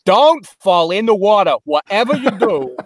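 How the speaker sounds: pushy New Jersey boatman; New Jersey accent. Acted. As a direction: warn them like a New Jersey ferryman. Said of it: "a, a New Jersey".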